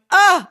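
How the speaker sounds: high loud moaning grunt; imitating her own moan in the cinema; pleasure moan.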